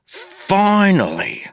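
high, drawn-out cry of relief; relieved exclamation. A narrator's reading. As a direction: hail long-awaited help. Relief as hero arrives.

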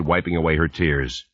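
plain reading voice

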